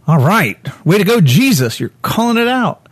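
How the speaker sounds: imitating the apostles egging Jesus on; mock cheering.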